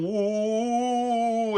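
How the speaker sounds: high, ghostly mock woo; imitated spirit woo. Spirit circling him at night, high ghostly woo. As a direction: hoot it like a passing ghost. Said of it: "like, literally going".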